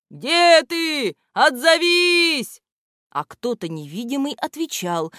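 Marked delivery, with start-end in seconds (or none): 0.1-2.6 high, loud, drawn-out calling; крик, зов. A narrator's reading. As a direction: call out for someone lost. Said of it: "и кричали". (громко и протяжно звать)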